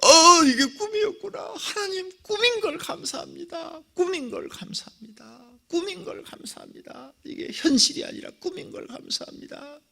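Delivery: high, crying voice of relief; weeping prayer of relief; tearful thanks. Retold or imitated. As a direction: sob out gratitude to God. Tearful thanks after dream.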